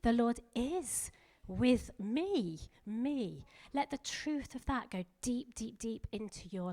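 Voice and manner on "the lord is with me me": "is" and "me" stretched slowly, "me" repeated; stress on is and me. Shown as a demonstration. Preacher models daily declaration, stressing "is" and "me". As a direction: draw out "is", then lean hard on "me". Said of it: "Emphasize a different word every day".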